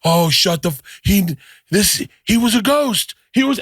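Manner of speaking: two strangers freaking out over the ending; excited disbelief. Retold or imitated. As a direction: gush about the twist, pitch climbing. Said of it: "we were like".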